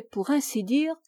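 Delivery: plain reading voice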